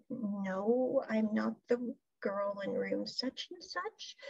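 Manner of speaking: slow, soft brush-off in a put-on woman's voice. Woman on the phone rebuffing Pat, soft and slow. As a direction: let him down quietly and coolly.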